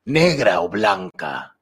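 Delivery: slow, lightly voiced sorcerer reading; wizard voice. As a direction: read it like an old warlock, slowly. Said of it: "yo seré un brujo". (voz de brujo lenta)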